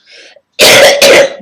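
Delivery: loud, harsh real cough; hard coughing fit. Real, not acted. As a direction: cough hard, breaking off mid-speech. Ill, coughing fit while talking.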